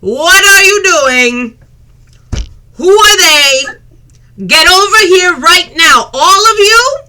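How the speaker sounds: put-on high, loud bellow at a runaway kid; a burly mother hauling everyone over; booming, furious matriarch calling her son; scolding mother. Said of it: "stands up and goes".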